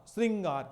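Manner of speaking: "श्रृंगार" with "ri", as "sringaar"; correct pronunciation repeated. Shown as a demonstration. Teacher points out ri to students, "श्रृंगार" as "sringaar". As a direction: say "श्रृंगार" with ri before the ng. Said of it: "री का pronunciation हो रहा है".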